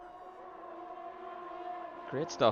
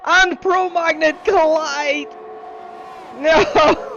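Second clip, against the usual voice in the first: much higher, louder, slow anguished cry; excited crash call; 11 dB louder, slower than usual. Acted. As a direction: scream it as the cars collide. Crash call at Eau Rouge.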